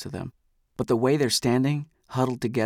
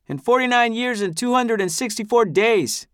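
much higher, loud defiant outburst; shouting; 11 semitones higher, 5 dB louder than usual. Acted. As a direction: shout it to cut him off. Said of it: "a voice shouts".

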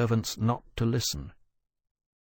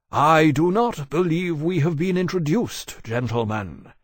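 frigidly aristocratic; 6 semitones higher, 4 dB louder than usual. Acted. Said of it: "in his most frigidly aristocratic accents".

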